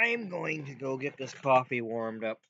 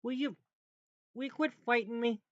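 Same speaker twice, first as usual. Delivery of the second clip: exasperated scolding; 11 semitones higher, faster than usual. Pretend. Scolding a stuck object.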